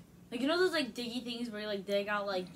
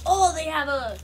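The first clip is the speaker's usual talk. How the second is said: loud, high burst of pretend delight; mock excitement; 8 semitones higher, 15 dB louder than usual. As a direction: exclaim as if thrilled by a find. Imagines exclaiming over candy in store.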